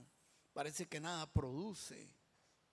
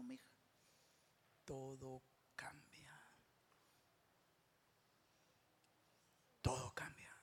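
hushed ministry. Real, not acted. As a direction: whisper it tenderly to the beloved. Hushed ministry after the climax.

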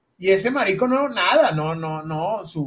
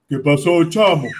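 Nelson's new deep voice; 5 semitones lower, 5 dB louder, slower than usual. Retold or imitated. Nelson answering Miguel after his voice broke, lower and louder. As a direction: lower and louder, a sudden grown-up voice. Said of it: "Nelson no habla así".

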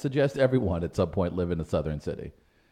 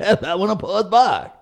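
higher, louder St. Louis voice through a laugh; laughing St. Louis accent; 10 semitones higher, 7 dB louder than usual. Retold or imitated. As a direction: mimic the women, laughing. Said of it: "we say it just like that".

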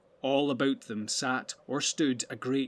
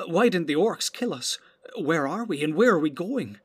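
rising, louder worried questions; anxious questions; 4 semitones higher, 6 dB louder than usual. A narrator's reading. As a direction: fret aloud, growing alarmed. Pippin's frightened questions.

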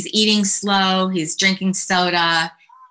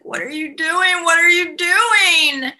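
put-on high, panicked kid's voice; freaked-out shriek; 11 semitones higher than usual. Gregory catching his parents, high shocked squeal.

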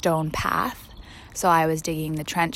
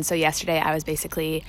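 usual speaking voice

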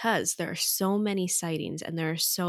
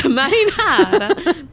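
mock Italian accent; 10 semitones higher, 11 dB louder, slower than usual.

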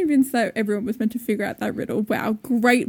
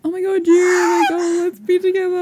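gushing lover; 7 semitones higher, 4 dB louder than usual. Retold or imitated. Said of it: "Cardan was, like, so".

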